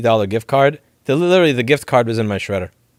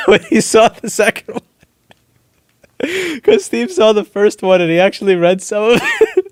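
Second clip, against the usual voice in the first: talking through laughter, higher and louder; can barely get words out for laughing; giggling while telling the story; 9 semitones higher, 6 dB louder than usual. Real, not acted. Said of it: "why I'm laughing so much".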